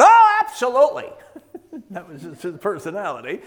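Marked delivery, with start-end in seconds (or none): 0.0-1.1 bursts out high and loud on Oh; imitating an eager old pastor's cry; emphatic exclamation. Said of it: "he would have spoken right out".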